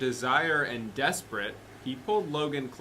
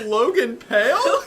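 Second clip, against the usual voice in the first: roared; 10 semitones higher, 8 dB louder, slower than usual. A narrator's reading. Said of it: "He roared".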